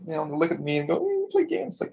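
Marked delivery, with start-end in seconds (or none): 1.0-1.7 very high-pitched mock voice; mimicking judgy people. People looking at a gamer, mockingly high. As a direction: put on a squeaky onlooker's voice.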